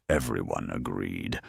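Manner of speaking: ordinary delivery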